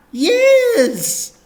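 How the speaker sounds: high, loud, over-eager vicar voice; high loud cheery vicar; gushing vicar. Acted. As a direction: beam it out like a toothy vicar.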